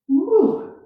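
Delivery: high strained ooh of a muscle burn; stretching groan. Real, not acted. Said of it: "all my hamstrings".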